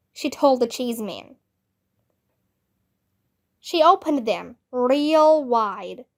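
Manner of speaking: ordinary delivery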